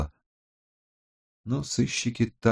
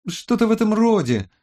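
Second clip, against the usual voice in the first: absent-minded repeat; 13 semitones higher, 4 dB louder than usual. A narrator's reading. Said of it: "рассеянно повторила Роза".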